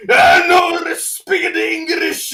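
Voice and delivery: loud, high put-on broken English voice; mock broken English. The restaurant answering in a loud mock broken English voice. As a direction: bark it out as a crude broken English caricature. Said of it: "P F Chang's be all like".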